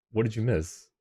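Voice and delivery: normal talking voice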